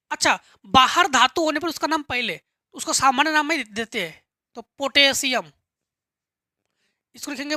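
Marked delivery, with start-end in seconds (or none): none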